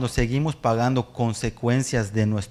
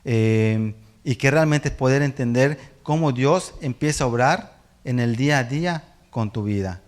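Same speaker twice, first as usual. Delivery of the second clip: ordinary talk, nothing marked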